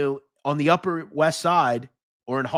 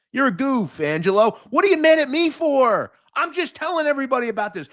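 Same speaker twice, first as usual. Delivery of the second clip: angry yelling; 10 semitones higher than usual. Real, not acted. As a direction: scold the heckler. Yelling at commenter Angelo.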